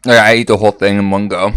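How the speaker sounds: loud, half-joking eating bit; loud mock eating talk. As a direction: boom it out as a joke. Mock mukbang demo.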